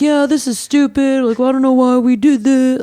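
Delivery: high, strained imitation of yelling; mock yelling. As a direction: retell an annoyed yell, pitched well up. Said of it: "or yelling".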